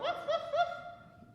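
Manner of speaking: high playful woof woof; dog barks. Pretend. Teacher barking for the kids, high and playful. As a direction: bark like a happy puppy. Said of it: "maybe you wanna go woof".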